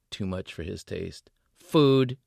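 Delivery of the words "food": curt. Acted. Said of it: "he said".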